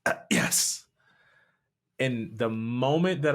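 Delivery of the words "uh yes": whispered, hesitant yes; muttered yes. Retold or imitated. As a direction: barely get the yes out. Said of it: "mutter out a".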